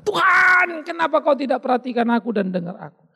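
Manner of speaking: mock the self-pitying pray-er; whiny complaining prayer. Wrong kind of prayer, a believer accusing God, high whiny complaint. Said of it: "Jangan pernah berdoa katakan".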